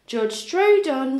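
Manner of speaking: plain reading voice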